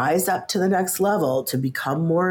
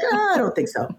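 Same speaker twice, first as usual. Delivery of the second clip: high-pitched, laughing brush-off; mock dismissive; 14 semitones higher, faster than usual. Retelling her refusal to younger adviser, laughingly dismissive. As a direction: wave the idea away in a high, amused voice. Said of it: "like, dismissive".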